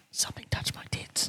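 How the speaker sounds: soundless unvoiced whisper; lipped whisper. Guest whispering to her mate, unvoiced. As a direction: barely breathe the words. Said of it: "read her lips going".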